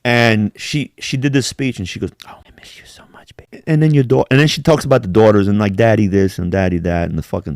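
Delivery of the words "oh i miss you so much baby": breathy mock-grieving widow whisper; mock Erica Kirk whisper. Mocking the widow's speech with a fake teary whisper. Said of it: "That's my imitation of fucking Erica Kirk".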